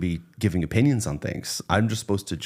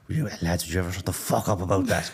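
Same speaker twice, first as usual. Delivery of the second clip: put-on Irish accent; 3 semitones lower than usual. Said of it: "you just go full Irish accent".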